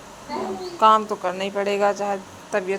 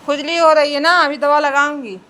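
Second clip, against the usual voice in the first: loud coaxing; 5 semitones higher, 8 dB louder than usual. Real, not acted. Mother soothing itchy child loudly.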